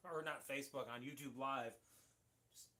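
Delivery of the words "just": coughing. Real, not acted. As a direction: cough breaking into the word. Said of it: "that went down the wrong pipe".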